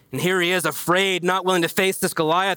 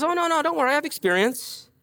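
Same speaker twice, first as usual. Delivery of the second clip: put-on eager boy; 8 semitones higher than usual. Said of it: "David says".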